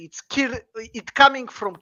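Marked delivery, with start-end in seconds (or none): none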